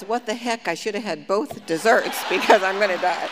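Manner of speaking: loud, laughing mock panic over dessert; laughing exclamation. Retelling her last thought while rafting, loud and laughing. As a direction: blurt it out through laughter. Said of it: "My last thought was".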